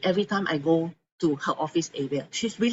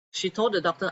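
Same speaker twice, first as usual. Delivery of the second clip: usual speaking voice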